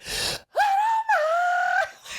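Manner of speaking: long, high-pitched fan scream; imitated concert shriek, held on one high note; mock scream. Fans ruining the girls' recording, high mock scream. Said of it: "We scream louder than we've ever screamed".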